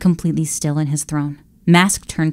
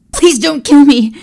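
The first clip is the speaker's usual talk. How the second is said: high, loud, terrified; frightened servant pleading; choked plea; 9 semitones higher, 8 dB louder than usual. Acted. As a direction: beg for your life. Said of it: "Jasper choked".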